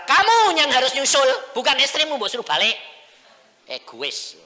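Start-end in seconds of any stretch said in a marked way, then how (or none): 0.0-2.7 very loud, high scolding as God; preacher voicing God scolding the father; God's loud rebuke. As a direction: boom it out as God. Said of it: "Tuhan berkata".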